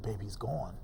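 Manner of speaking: low, hushed delivery; quiet bad news. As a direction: break it softly, as the wife did. Retells wife breaking death news.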